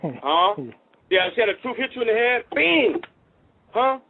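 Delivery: ordinary delivery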